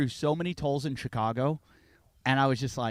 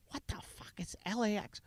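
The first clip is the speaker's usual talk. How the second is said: hushed, baffled whisper; replaying his confusion at the notice; 6 semitones higher, 11 dB quieter than usual. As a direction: breathe it out baffled.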